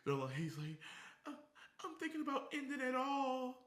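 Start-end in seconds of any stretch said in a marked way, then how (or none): 1.3-3.5 mock sad caller. Mocking a sad hotline caller.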